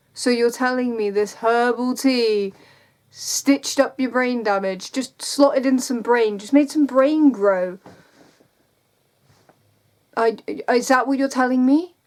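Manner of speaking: loud, incredulous and sarcastic; incredulous rant. Real, not acted. Ranting at Instagram commenters.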